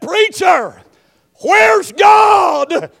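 anguished plea. Retold or imitated. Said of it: "he said this to me over the phone crying".

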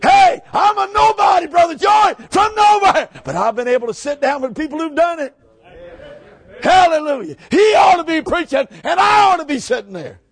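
loud, high shouted preaching; fiery preaching. Acted. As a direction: preach it at full force to the congregation. Preaching at full force.

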